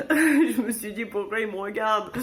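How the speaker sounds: chuckling at the awkwardness; laughing. Real, not acted. Laughing retelling the awkward stares. (en riant, incrédule)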